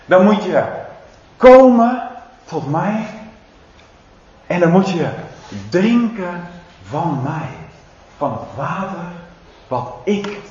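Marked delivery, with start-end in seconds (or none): none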